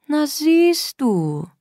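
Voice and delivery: high, very slow croon; tender, gentle. A narrator's reading. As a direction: soothe sweetly after getting your way. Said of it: "mit einem Mal zärtlich und sanft". (zärtlich und sanft gedehnt)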